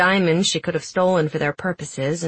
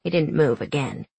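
plain reading voice

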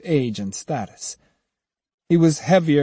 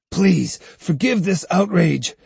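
pleading; 5 semitones higher than usual. A narrator's reading. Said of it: "pleaded Sahe".

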